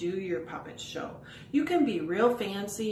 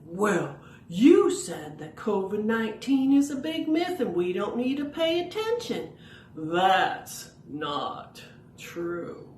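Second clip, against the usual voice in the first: louder, correcting puppet, very slow ending; Bowser puppet voice; 4 dB louder than usual. Pretend. Bowser corrects Rory's rumour, slow and firm. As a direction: set the record straight, drawn out. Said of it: "Bowser's like".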